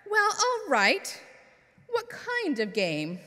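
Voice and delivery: high, slow voiced fox lighting up at the game; a delighted fox, drawn out; lightly voiced sly fox; Mister Fox, eager. A narrator's reading.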